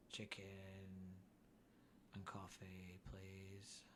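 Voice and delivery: flat, toneless order, slow and low-key; monotone voice. Pretend. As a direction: order food like a lifeless weirdo. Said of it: "I would just try and sound monotone".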